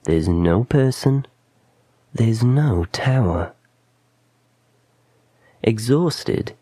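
plain reading voice

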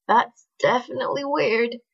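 choked, strangled voice. A narrator's reading. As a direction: squeeze it out through a tight throat. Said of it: "he said in a strangled voice".

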